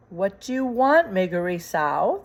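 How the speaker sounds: usual speaking voice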